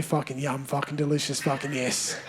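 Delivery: low, soft muttered self-affirmation; quiet mirror affirmation. Retold or imitated. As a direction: say it softly to yourself in the mirror. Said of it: "I look myself in the mirror and I say".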